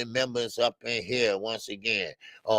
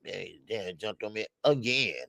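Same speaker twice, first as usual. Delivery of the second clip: ordinary talk, nothing marked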